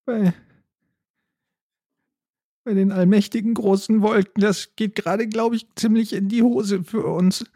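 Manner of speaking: high, worried raccoon voice. Acted. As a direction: fret high-pitched about trouble. Raccoon character frets.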